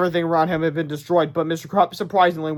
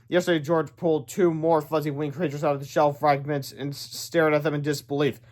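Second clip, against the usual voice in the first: ordinary delivery, nothing marked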